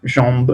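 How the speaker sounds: jambe with nasal an, silent final e; French jambe. Shown as a demonstration. Teacher to class, modelling French jambe. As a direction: say jambe as ʒɑ̃b. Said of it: "acuérdense que la E final".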